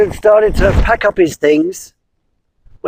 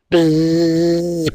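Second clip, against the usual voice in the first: buzzing; 2 semitones lower, 4 dB quieter than usual. Acted. Storyteller buzzing like a bee. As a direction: buzz like a bee.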